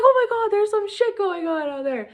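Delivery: high, loud astonished exclamation with a laugh; shocked amazement. Pretend. Seeing the universe's eyes look back, astonished. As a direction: gasp it out in disbelief.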